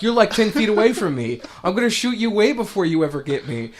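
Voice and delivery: high and loud cocky cop; mock cop taunt. Cop taunting JoJo from afar, high loud mock voice. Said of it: "the dude's like".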